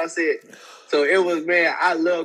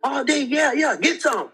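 higher, hyped-up smack talk on the sideline; imitating trash talk; 8 semitones higher than usual. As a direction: hype him up like a cocky coach. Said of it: "he's all talking smack to the other player".

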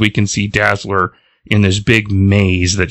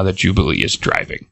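ordinary talk

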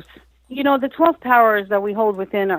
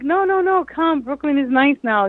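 high-pitched imitation of a friend's reassurance; coaxing; 7 semitones higher than usual. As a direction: wave away the worry and coax. Friend coaxing her in retold talk.

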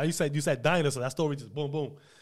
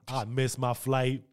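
usual speaking voice

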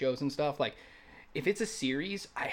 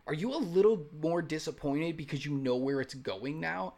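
ordinary talk, nothing marked

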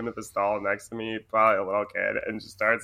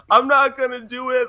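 put-on high, loud kid refusal; defiant kid; 13 semitones higher, 8 dB louder than usual. Crying little boy refusing his mom, high and loud. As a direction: whine it like a stubborn child. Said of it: "He's like".

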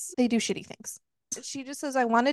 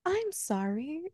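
put-on higher, soft little-girl apology; a mock-innocent sweet little sorry; 5 semitones higher, 7 dB quieter, slower than usual. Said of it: "such, like, a sweet little voice".

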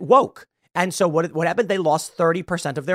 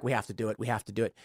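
much softer, mumbled mock reply of the brewery; mock company reply; 7 semitones lower, 11 dB quieter, much faster than usual. Mocking Bud Light bosses answering the warning, softly. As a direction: play the brewery bosses, resigned and hushed. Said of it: "And they were like".

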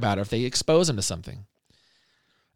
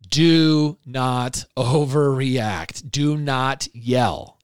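very slow, word-by-word insistence; emphatic plea; much slower than usual. Real, not acted. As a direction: DO NOT drawn out, each word separate.

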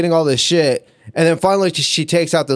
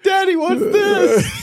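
put-on high, slow little kid asking; a small child holding up the sock; much slower than usual. Child asking dad about the sock, high childish voice.

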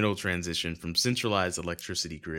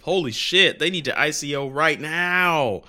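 loud, high, hammed-up excitement; mock hype; 7 semitones higher, 8 dB louder than usual. Host jokingly hypes a crypto project to co-host, mock excitement. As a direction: fake being blown away, building to a shout. No, I'm kidding.

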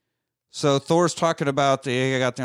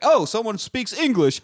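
quoting Stark; 9 semitones higher than usual. Retold or imitated. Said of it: "Stark's like".

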